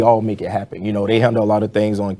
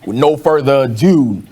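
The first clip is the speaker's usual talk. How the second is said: booming announcer introduction, higher and louder; fighting game announcer; 4 semitones higher, 4 dB louder than usual. Pretend. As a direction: hype up the entrance like a ring announcer. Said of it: "You have to do your announcer voice for this one".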